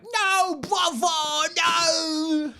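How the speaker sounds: high wailing cry; mock anguish over his brother; hammed-up grief. Twin mourns dead brother.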